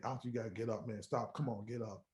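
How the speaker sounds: low, soft, urging guard; hushed, subdued retold appeal; quiet coaxing. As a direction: imitate a guard gently urging someone up. Guard coaxing him off the floor.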